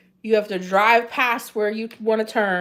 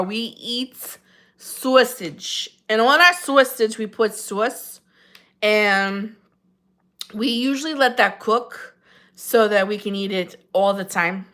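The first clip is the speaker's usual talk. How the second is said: Jersey Shore accent. Shown as a demonstration. Streamer showing off Jersey talk on request, Jersey Shore accent. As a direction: put on a Jersey Shore accent, leaning on the vowels. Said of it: "Can you do a Jersey Shore accent for me?".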